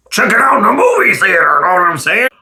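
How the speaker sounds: usual speaking voice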